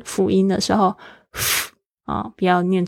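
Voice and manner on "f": F as a bare puff of air; just breath between lip and teeth for F; voiceless F. Shown as a demonstration. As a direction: make only the F sound, with no voice and no vowel. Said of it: "既然它是这个无声的子音".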